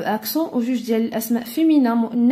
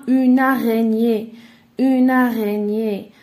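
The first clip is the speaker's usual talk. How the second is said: loud, slowly drawn-out dictation; 6 dB louder, slower than usual. Shown as a demonstration. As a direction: say it slowly and loudly for pupils to write. Teacher dictates the example word slowly. (dictée lente et forte)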